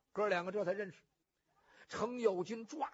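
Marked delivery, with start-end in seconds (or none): none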